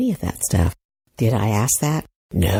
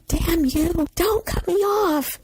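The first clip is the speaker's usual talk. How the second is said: imitated outraged shriek at usual volume; mock scream; slower than usual. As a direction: scream it in pretend, way up high. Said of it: "I wanted to scream at them".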